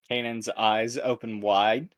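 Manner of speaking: ordinary talk, nothing marked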